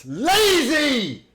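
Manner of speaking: sudden high shout of disgust; angry yell. Real, not acted. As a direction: bark it at the player. Yelling at player on screen.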